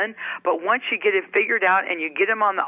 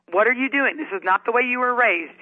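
higher-pitched retold telling-off; scolding mother; 4 semitones higher than usual. Mother to kids home from bars, scolding. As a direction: scold them like mom with her finger out. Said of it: "mommy finger came out".

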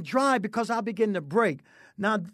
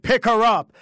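louder, higher, forceful command; with authority; 4 semitones higher, 8 dB louder than usual. Retold or imitated. The Lord tells the driver again, with loud authority. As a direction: order it firmly, raising the voice. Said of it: "with authority and said".

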